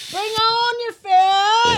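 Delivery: high, slow wailing shout. Acted. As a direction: belt it out, drawn out. Hyping up the fatty beer.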